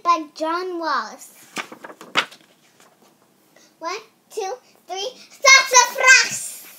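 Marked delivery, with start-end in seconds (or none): 3.8-6.7 loud, high cheer on sassafras; shouted sassafras. Acted. Reader cheering the title word loud. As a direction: call it out to start the story.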